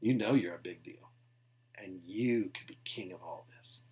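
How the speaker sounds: a hint of a sly devil flattering; voicing Satan. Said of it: "Satan's trying to tell Jesus".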